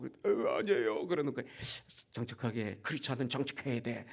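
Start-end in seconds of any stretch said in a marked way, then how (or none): 0.0-1.1 mock crying voice; imitated tearful denial. Crying staffer denying it to his pastor, imitated tearful voice. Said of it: "왜 울었어". 2.1-4.1 soft, restrained counsel; quiet admonishing. Retold or imitated. Pastor coaxing staffer to be honest, hushed and firm.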